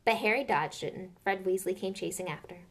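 ordinary delivery, nothing marked

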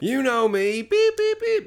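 high bleeps imitating a censor tone; censor beep. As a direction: mimic the TV bleep, high and loud. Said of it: "it's like".

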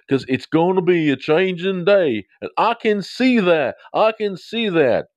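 high, loud Texan talk-show drawl; Doctor Phil. Acted. Host quoting a Texan TV doctor, hammed-up impression. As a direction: stretch it out like a Texan TV counsellor. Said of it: "everybody in Texas was related to Doctor Phil".

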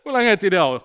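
fuller, livelier Brabant dialect; Brabant accent. Acted. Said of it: "Dat is Brabant".

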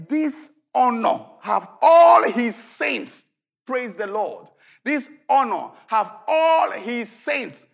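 loud, slow preaching; preaching at full force. Acted. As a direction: drive the verse home to the church. Preaching climax.

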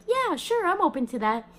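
high, bright-voiced lady agreeing; eager lady. A stranger says yes to healing, high and eager.

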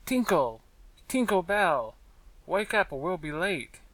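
small, clear voice calling out; very distinct. A narrator's reading. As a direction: call someone awake very clearly. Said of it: "said very distinctly".

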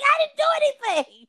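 high, loud laughing squeal of protest; mock-defensive, high-pitched outburst; protesting innocence. Retells protesting innocence to the room.

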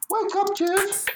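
very high woman's voice; high-pitched call without added volume; high wake-up call. A narrator's reading. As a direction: wake him in a squeaky-high voice. Woman waking him in the story.

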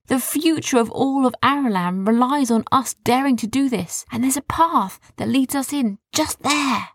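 a hint of a determined boy; lightly voiced brave boy. Arthur character voice.

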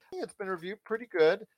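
ordinary talk

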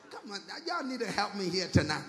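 normal talking voice

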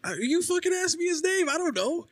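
high indignant protest; exasperated. Real, not acted. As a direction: throw up your hands, exasperated. Defensive outburst at friend.

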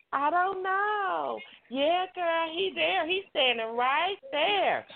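high, slow mocking cousin voice; a high mock of the cousin giving them away. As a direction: mimic the cousin, high and drawn out. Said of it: "She was".

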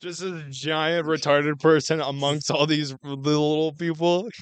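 usual speaking voice

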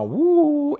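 high hooting uh of scandal; mock shocked uh. Teacher reacting to the scandal, high mock hoot. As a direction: squeal it like juicy gossip.

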